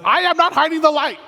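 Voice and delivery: high, loud, put-out protest, acted out; indignant. Praying over sermon notes, protesting to God, acted indignant outburst. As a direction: replay the moment you snapped back, offended. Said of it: "there was sort of an indignant tone in my voice".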